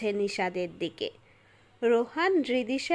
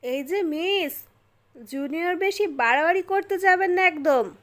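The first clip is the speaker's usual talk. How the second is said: firm, higher-pitched warning to a junior; grave, stern; 6 semitones higher, 4 dB louder than usual. A narrator's reading. Senior boy warning a drenched girl off, grave and stern. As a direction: lay down the rules sternly. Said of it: "গম্ভীর গলায় বলল".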